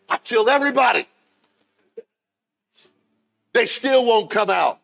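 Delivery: high, loud war-story climax; worked-up retelling. Retold or imitated. Preacher recounts wiping out the whole camp, worked-up retelling.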